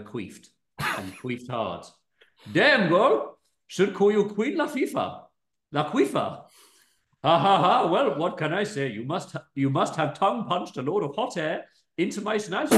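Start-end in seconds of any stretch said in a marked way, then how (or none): 2.5-12.7 higher, hammy Indian voice for James; a cheeky Indian lad teasing; Indian accent. Acted. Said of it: "gonna plump on Indian".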